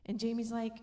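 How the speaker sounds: normal talking voice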